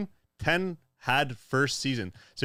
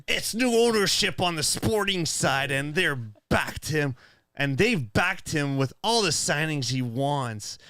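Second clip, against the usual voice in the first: evil character voice; 3 semitones higher, slower than usual. Acted. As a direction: read it like a cartoon villain. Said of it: "It sounds like an evil character".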